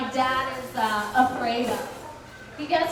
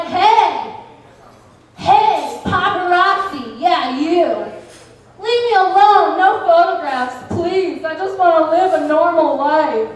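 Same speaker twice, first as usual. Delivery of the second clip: loud, higher mock celebrity plea; attention-seeking nobody; 4 semitones higher, 8 dB louder than usual. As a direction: yell for the paparazzi like a wannabe star. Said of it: "they're like".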